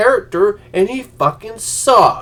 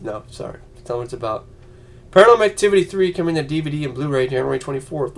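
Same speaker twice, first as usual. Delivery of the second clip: usual speaking voice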